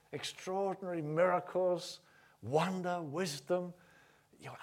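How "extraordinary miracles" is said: ordinary talk, nothing marked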